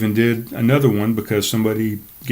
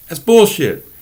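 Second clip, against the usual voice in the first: scoffing dismissal; 7 semitones higher, 5 dB louder, slower than usual. Real, not acted. Scoffs at common excuse.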